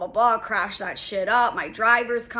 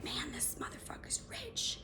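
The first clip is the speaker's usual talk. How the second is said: voiceless whispered aside; 18 dB quieter than usual. Retold or imitated. An onlooker's imagined remark about a rich man, whispered. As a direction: breathe it as a secret aside.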